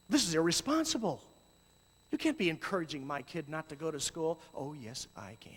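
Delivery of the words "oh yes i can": hushed, voiceless yes I can; defiant whisper. Real, not acted. Whispering back to a worried parent, defiant and hushed.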